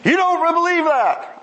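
high mock bellow of an angry priest; retold bellow. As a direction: ham up the priest yelling, pitched high. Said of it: "started bellowing".

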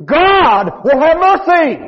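high, slow preached cry; preacher crying out for mercy; fervent cry. Real, not acted. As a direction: call it out high and drawn out.

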